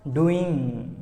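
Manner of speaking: डूइंग ending in nasal ng without ga; correct ng. Shown as a demonstration. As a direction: model डूइंग properly. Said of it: "आखिरी में गा का sound नहीं आएगा".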